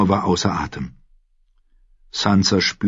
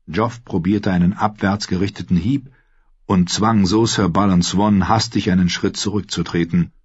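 ordinary delivery

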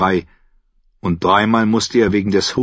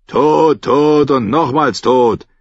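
higher, louder, dismissive guard voice; gruff guard; 6 semitones higher, 5 dB louder, slower than usual. Acted. Guard dismisses the knight at the gate. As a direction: brush off the drunk.

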